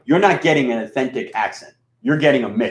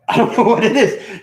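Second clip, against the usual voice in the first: laughing; 9 semitones higher, 4 dB louder than usual. Real, not acted. Amused about him and Mike.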